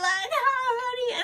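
high, sing-song fake husband greeting; mock sweet guy. Mocking a romance movie husband greeting his wife. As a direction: coo it like a corny movie husband. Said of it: "be like".

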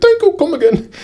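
put-on high, hammy Indian accent; mock Indian shopkeeper accent. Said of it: "my Indian accent sucks".

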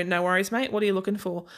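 ordinary talk

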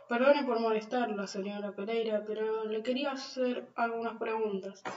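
plain reading voice

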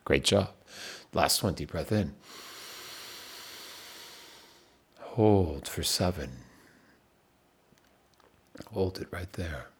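calm counting coach, nearly whispering by the end; guided breathing. Acted. Leads a timed breathing exercise.

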